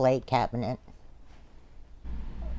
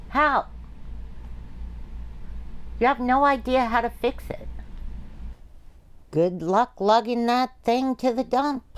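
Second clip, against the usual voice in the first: higher, sharp and scornful; angry retort; 7 semitones higher than usual. Acted. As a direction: lash back, mocking their plan. Quarrel over the broken fridge.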